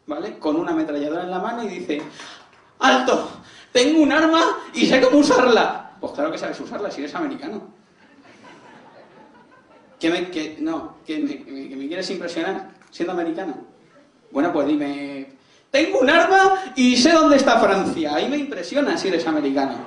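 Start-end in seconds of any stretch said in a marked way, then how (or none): 2.8-6.0 loud, high, slow nervous shout; hammed-up movie gunman yelling a threat. Jumpy gunman in an American film threatens someone, nervous shout. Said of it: "Sale un tipo muy nervioso". 15.7-18.1 loud, high, drawn-out threat; mock movie gunman yelling slowly; gunman's shout. Comic rewrites the American gunman's threat, hammed-up shout. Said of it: "Sale un tipo muy nervioso".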